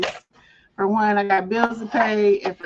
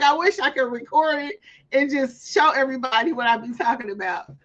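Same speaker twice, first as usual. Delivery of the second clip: ordinary delivery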